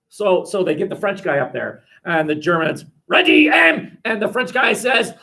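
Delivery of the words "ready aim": high, loud barked order; acted officer's shout; firing squad command. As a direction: call the squad to attention. German firing squad command repeated.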